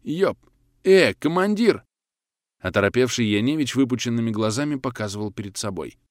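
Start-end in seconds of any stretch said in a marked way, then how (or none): 0.0-1.8 high, louder alarmed exclamation; startled call. A narrator's reading. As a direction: call the commander in sudden surprise. Startled soldier alerts his commander. (испуганный оклик командиру)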